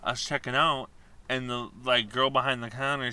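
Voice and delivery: ordinary talk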